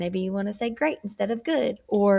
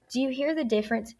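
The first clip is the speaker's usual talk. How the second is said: ordinary talk, nothing marked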